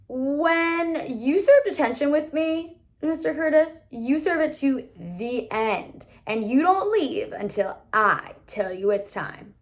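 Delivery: a hint of a stern teacher, slowed and firm. A narrator's reading. Teacher warning a student in detention, lightly stern. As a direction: lay down the law, lightly voiced.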